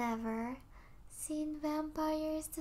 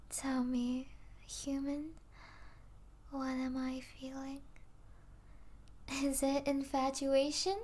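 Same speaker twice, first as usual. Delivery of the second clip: ordinary delivery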